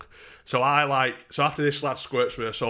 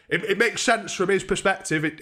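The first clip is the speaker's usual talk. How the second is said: ordinary delivery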